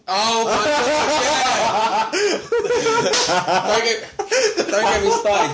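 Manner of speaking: normal talking voice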